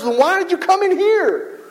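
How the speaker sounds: high, slow exasperated question; exasperated owner. Retold or imitated. Restaurant owner wondering at an unhappy customer, high and exasperated. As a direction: let the frustration show in a drawn-out ask. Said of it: "I bet she sometimes wonders".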